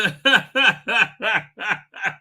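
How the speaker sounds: long, high peal of laughter; hearty laugh. Real, not acted. Host tickled by his male gaze joke, laughing.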